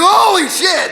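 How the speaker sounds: high, loud mock dismay; shocked. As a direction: exclaim it like a stunned kid. Child reacts to bad news.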